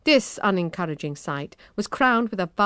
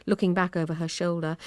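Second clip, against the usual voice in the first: plain reading voice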